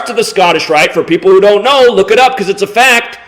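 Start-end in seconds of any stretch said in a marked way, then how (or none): none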